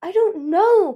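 high, loud, helpless outburst; panicked wail; distressed cry. A narrator's reading. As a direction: cry it out in despair. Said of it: "Peril cried".

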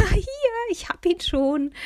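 triumphant "found it!"; gleeful old lady calling out; happy call. A narrator's reading. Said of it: "rief sie und freute sich".

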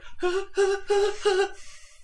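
short heavy breaths, one after another; acted strained panting. Game master acting the rescuer clinging on, strained panting. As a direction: puff as if barely holding someone's weight. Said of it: "and you're just like".

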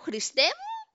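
sudden very high mock-panicked cry; acted alarm. Passenger calling on Christ above the Aegean, high acted alarm. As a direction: let the pitch leap in mock fright. Plane dropping over the sea.

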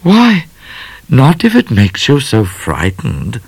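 high, lilting teasing "why"; amused. A narrator's reading. Miss Laura teasing Midnight about being frightened, amused. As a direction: tease lightly, starting high. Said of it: "laughed Miss Laura".

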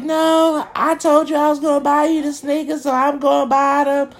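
high voice for the woman insisting; mock woman. The woman insisting she'll buy his sneakers, high-voiced. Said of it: "She was like".

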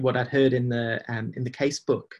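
ordinary delivery

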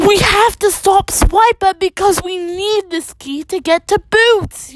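high-pitched storybook yell; urgent yell. Acted. Nora needing the key to rescue Boots, yelling. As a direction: pitch it high all through, pleading urgency. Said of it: "yells Nora".

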